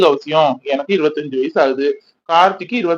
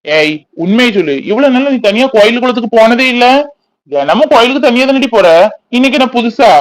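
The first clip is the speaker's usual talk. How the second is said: higher, louder mocking scold; sarcastic teasing; 6 semitones higher, 5 dB louder than usual. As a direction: needle her with sarcasm. Anbu teasing Maha sarcastically.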